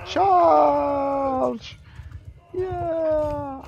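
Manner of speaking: very high, loud charge shout; rally the troops to charge; battle cry. Real, not acted.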